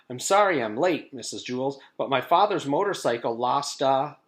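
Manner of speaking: high, loud, hurried apology; a late girl rushing to explain; breathless schoolgirl Jenny. A narrator's reading. Jenny bursting in late to her teacher, high and loud.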